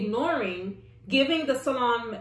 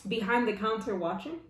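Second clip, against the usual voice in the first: ordinary talk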